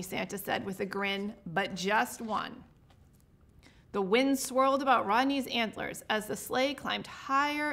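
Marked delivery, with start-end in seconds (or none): 1.5-2.6 slow. Acted. Santa giving in slowly.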